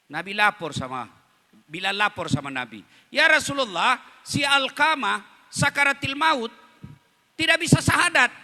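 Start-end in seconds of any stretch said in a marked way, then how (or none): 3.1-8.3 urgent report. Acted. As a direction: rush the bad news to the Prophet, pitched up high. Bilal urgently reporting to Prophet. (melapor dengan panik, suara tinggi dan keras)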